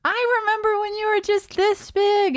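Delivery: high sweet cooing adult; nosy old relative voice. Retold or imitated. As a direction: coo it like a doting aunt. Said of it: "they're like".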